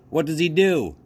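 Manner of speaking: put-on a nosy boy pressing a girl; high loud boy voice; curious boy. A narrator's reading. Boy asks about the rabbit.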